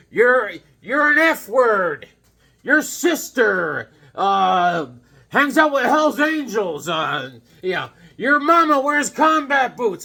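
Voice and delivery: loud, high, slow mock rant; imitating a ranting man. The man threatening churchgoers, loud, slow mock rant. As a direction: ham up a raving man's threats. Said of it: "swearing and threatening people's kids".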